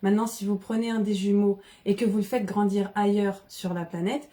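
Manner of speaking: ordinary delivery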